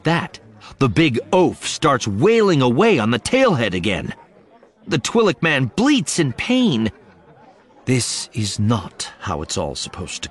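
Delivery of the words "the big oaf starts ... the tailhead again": ordinary delivery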